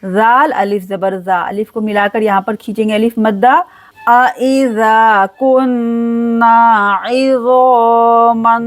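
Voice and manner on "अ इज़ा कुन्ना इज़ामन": a iza kunna izaman, long vowels drawn; slow recitation. Shown as a demonstration. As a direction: recite slowly, stretch za. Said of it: "दो second के लिए खींचेंगे".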